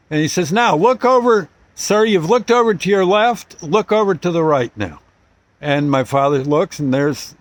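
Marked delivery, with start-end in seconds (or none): 0.5-5.0 higher, louder pointed instructions; insistent cop. Retold or imitated. Cop directs the father to look at the squad cars, insistent. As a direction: make him see it, emphatic.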